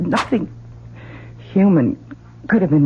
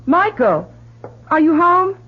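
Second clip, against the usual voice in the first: raised voice searching for her son; calling out; 14 semitones higher, slower than usual. Acted. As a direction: call up the stairs to find him. Calling through the house.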